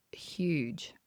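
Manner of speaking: breathed, almost voiceless; whispered. Real, not acted. Describing the vision to the host, whispered. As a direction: whisper it in awe.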